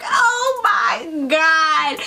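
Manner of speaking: drawn out, horrified; frozen in fear, stretch each word; slow panicked gasp. Retold or imitated. Retelling hearing the knock, slow horrified oh my God.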